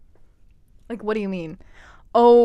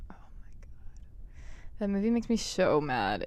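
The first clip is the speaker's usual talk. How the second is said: ordinary talk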